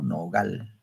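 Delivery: "Nogal" with the last syllable "gal" drawn out; stress on gal. Shown as a demonstration. Teacher pointing out the stressed "al" in "Nogal". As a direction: lean softly on the "al" in "Nogal". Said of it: "Observen el al ahí".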